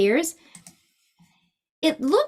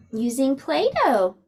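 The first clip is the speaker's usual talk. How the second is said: ordinary delivery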